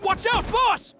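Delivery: sudden high warning shout. Acted. Paimon-like companion shouting a warning to the boss. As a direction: yell the warning across to the boss.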